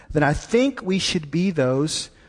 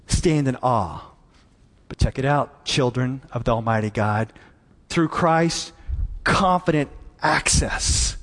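ordinary talk, nothing marked

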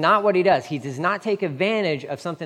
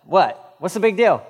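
louder, baffled what's the big deal; mock disbelief; 3 semitones higher, 6 dB louder than usual. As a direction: toss it back, incredulous and loud. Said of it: "Peter's like".

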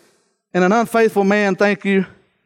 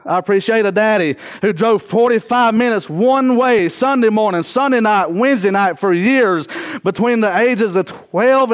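rising, fervent preacher voice, higher; preaching; 4 semitones higher than usual. Acted. Preacher builds to full force.

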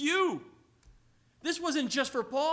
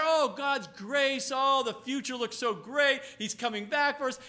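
rosy mock gush; 3 semitones higher, 7 dB louder than usual. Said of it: "You can sit there".

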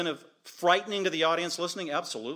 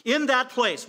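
preaching emphasis; 10 semitones higher, 9 dB louder, slower than usual. Real, not acted. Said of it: "preaching with full force".

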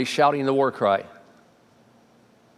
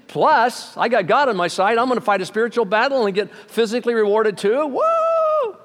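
excited whoop; 7 semitones higher than usual. Retold or imitated. David thrilled about fighting for a reward, shouting. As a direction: cheer like you just won the prize.

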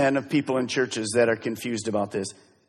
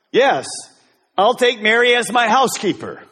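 lightly voiced groom, higher and louder; groom's reply; 6 semitones higher, 5 dB louder than usual. Groom answering the minister, light mock voice. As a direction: answer like a cheerful clueless groom. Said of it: "And he responds".

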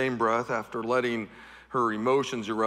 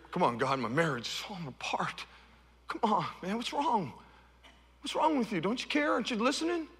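a touch of a whiny, exasperated believer, higher; mock-complaining to God, voice rising; frustrated plea to God; 7 semitones higher, 4 dB quieter than usual. Worshipper complaining to God, mildly whiny plea.

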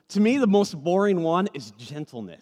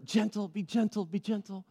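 softer, fading gentle be gentle; hushing a baby; 4 semitones higher, 6 dB quieter than usual. Retold or imitated. Parent softly telling a baby to be gentle. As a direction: coax a toddler softly. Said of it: "you're gonna use that term".

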